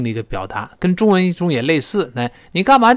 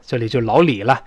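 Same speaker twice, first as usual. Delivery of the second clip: normal talking voice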